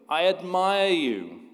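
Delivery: slow, high echo; the mountain echoing the praise; echo shouting back. Acted. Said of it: "The mountain shouts back at him".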